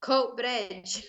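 tentative imitation of a Scottish accent; attempted Scottish accent. Shown as a demonstration. Guest saying a town name on the host's request, attempted Scottish accent. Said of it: "Say Coatbridge in a Scottish accent".

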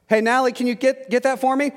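calling out. Retold or imitated. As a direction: imitate a mom calling through the house. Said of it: "to yell louder".

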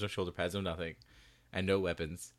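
usual speaking voice

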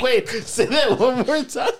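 slow words through real laughter; can barely talk for laughing. Real, not acted. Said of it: "laughing hard".

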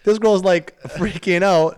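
loud, high, worked-up outburst; excited. Real, not acted. Guy tells friends about the crying girl, loud and excited. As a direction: get fired up telling it. Excited retelling of prom drama.

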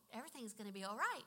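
ordinary delivery

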